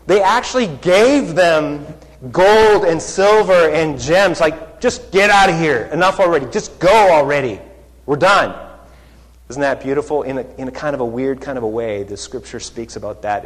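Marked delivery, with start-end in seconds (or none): 4.8-8.5 fed-up dismissal. Retold or imitated. Egyptians dismissing the Israelites.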